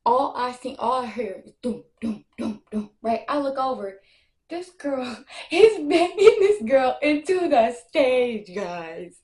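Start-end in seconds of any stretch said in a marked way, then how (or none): 4.5-9.2 laughing. Real, not acted. Laughing recounting fight on stage.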